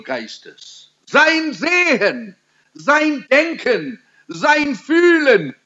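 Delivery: high, slow rhetorical list; fervent preaching. Real, not acted. Preacher lists all in Stephen that was Spirit-filled, fervent preaching. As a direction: ring out each item at high pitch, drawing it out.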